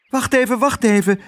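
high, urgent plea to wait; anxious high-pitched call; urgent holding back. A narrator's reading. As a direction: rein them in, high and insistent. Otje stops the excited mice.

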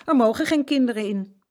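loud, high, emphatic refusal; very decided. A narrator's reading. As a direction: shut them down firmly. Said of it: "zei heel beslist".

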